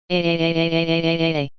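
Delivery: repeated breathless eh cries; frantic crying eh eh eh; shrieking sobs. A narrator's reading. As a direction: sob out each eh in panic. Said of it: "shrieked and started running about, crying hysterically".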